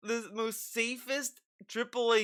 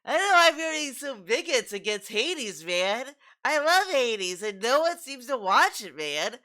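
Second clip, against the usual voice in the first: high, mocking whine of a gamer who feels picked on; mocking whiny gamer; 4 semitones higher than usual. Mocking the YouTuber's complaint about Hades, in a high whine. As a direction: pitch it up and whine like a wounded creator. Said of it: "And he's like".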